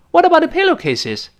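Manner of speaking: first dialogue speaker. Acted. First person asks what was washed, high-pitched questioner. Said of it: "两个人对话开始".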